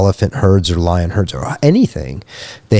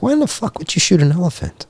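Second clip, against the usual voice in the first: incredulous; 10 semitones higher, 3 dB quieter than usual. Retold or imitated. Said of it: "It's like".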